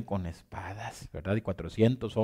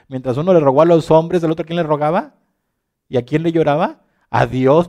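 loud, higher, rising sermon climax; preaching at full force; 5 semitones higher, 10 dB louder than usual. Real, not acted. Preacher challenges the church, loud and high. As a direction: drive the question at the congregation.

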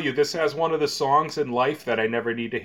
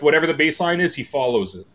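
ordinary talk

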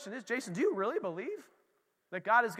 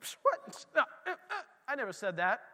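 defensive denial; 2 semitones higher than usual. Retold or imitated. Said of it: "That's me".

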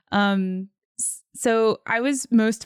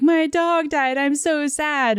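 put-on whiny sad kid; 7 semitones higher, 3 dB louder, slower than usual. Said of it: "the whole thing was like".

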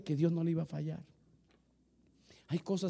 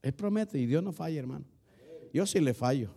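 usual speaking voice

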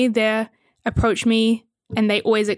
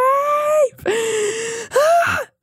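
1 dramatic gasp. Real, not acted. Dramatic gasp after question.

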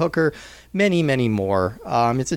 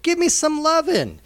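higher, playful showman plea; hammy showman; 13 semitones higher than usual. Acted. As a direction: ham it up for love. Hammy welcome bit.

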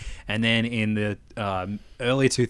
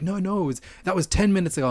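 indignant customer; 8 semitones higher, faster than usual. Retold or imitated. Customer voiced in retold argument.

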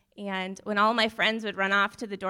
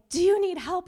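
frantic plea; 10 semitones higher, 9 dB louder than usual. Retold or imitated. Panicked hallway plea retold.

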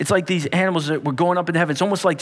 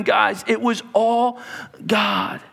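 ordinary delivery

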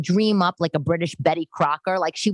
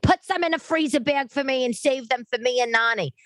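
mock mother; 8 semitones higher than usual. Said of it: "My mother's like".

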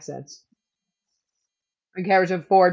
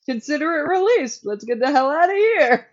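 higher, keen and a bit louder; eager quote; 9 semitones higher, 4 dB louder than usual. Retold or imitated. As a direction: rush it, eager to leave. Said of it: "Daniel's like".

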